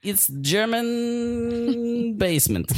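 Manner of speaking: put-on slow, drawn-out made-up German accent; mock German accent. Asked about her accent, the basement character answers in slow mock German. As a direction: stretch every word in a silly German basement accent. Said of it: "I'm having trouble placing your accent".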